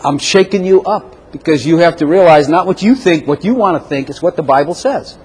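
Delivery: ordinary talk, nothing marked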